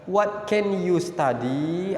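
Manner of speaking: slow model question. Shown as a demonstration. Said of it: "tinggal ditambah saja".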